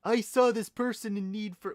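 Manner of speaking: high, loud put-on Canadian protest; hammed-up, badly faked Canadian accent. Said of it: "do a good Canadian accent".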